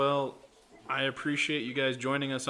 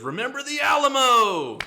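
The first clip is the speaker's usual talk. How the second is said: much higher and louder shouted battle cry; 13 semitones higher, 15 dB louder than usual. Retold or imitated. American tosses the Mexican out, loud shouted war cry.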